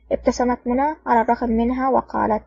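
usual speaking voice

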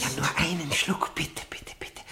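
soft, breathless whispered begging; pleading whisper. Acted. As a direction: beg the guard under your breath. Prisoner begging the guard.

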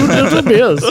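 high, laughing mock Indian-sounding accent; a jokey stab at a foreign accent over a laugh; botched accent. Podcast host tries an accent for tiny beers, laughing mock Indian-sounding accent. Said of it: "I don't know what accent that was".

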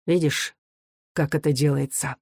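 glum, subdued question; understated moroseness; gloomy. A narrator's reading. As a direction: say it sullenly, without lift. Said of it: "говорил угрюмо".

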